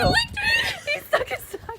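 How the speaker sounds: high, loud shrieking squeal; excited squeal. Real, not acted. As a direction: squeal with giddy disbelief. Just licked by a goat.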